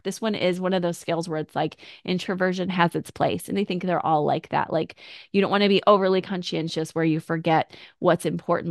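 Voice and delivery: ordinary delivery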